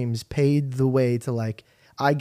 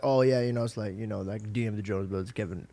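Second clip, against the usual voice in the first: much softer, lower, bored shrug; mock nonchalance; 7 semitones lower, 9 dB quieter than usual. Pretending fame is normal, mock bored. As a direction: toss it off like it's no big deal. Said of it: "be like".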